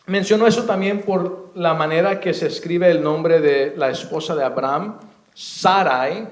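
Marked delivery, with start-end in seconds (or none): 5.3-6.3 "Sarai" as SA-rai, first syllable stressed; careful correct "Sarai", accent up front. Shown as a demonstration. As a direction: say "Sarai" with a gliding final ai. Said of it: "No es Sarai con acento en la I".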